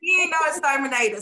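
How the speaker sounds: high-pitched, scoffing dismissal; indignant protest. Retold or imitated. Acting out protest about her husband.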